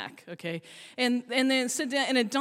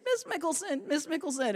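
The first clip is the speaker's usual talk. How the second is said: put-on a small child's plea, pitched up; mock kid calling, getting higher; kid's voice rising higher; 5 semitones higher, 4 dB quieter than usual. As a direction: lift the pitch as the kid gets more insistent. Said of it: "it's getting a little higher pitch".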